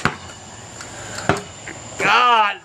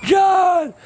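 mock gushing Hogan; 7 semitones higher, much slower than usual. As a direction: ham up a fawning call to John. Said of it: "saying that he was talking to y'all".

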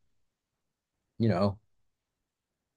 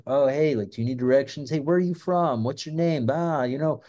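higher-pitched, over-friendly hustler act; mock-friendly street hustler, pitched up; con man's patter; 5 semitones higher than usual. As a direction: play a chummy stranger fishing for details. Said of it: "the classic con man trick".

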